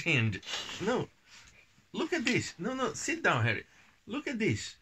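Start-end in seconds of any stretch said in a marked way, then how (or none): none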